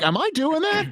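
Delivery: a jolt of mock panic; alarmed disbelief. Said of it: "I'm like".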